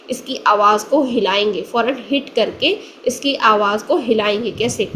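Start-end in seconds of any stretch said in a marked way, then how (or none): none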